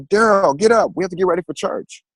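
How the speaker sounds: high, loud call to wake someone. Retold or imitated. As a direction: shout through the house to wake him. Calling out to wake father.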